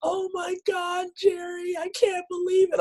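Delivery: hammed-up weepy falsetto; mock crying. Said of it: "She's crying. She's like".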